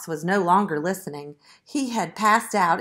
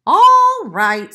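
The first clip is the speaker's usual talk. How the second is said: high, loud, exaggerated sigh; 11 semitones higher, 14 dB louder than usual. A narrator's reading. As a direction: give in with a big piglet sigh. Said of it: "sighed the piglet".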